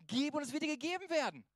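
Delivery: a clear authoritative saying of Jesus; voice of Jesus with authority. Retold or imitated. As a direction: pronounce it like an unquestionable command. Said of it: "klare Aussage mit Autorität".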